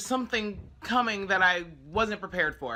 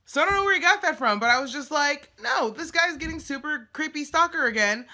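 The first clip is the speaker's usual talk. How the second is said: much higher and louder, quickly, exasperated; fed-up rant; 9 semitones higher, 6 dB louder than usual. Real, not acted. Telling viewers the stalker got creepy, loud and fed up. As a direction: vent it all at once.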